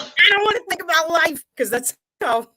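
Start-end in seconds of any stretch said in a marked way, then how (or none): 0.0-1.4 much higher mock whine; whiny improviser. Co-host mocks improvisers refusing to think about life, high whiny voice. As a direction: squeak it out like a petulant improviser. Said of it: "'cause that's how improvisers sound".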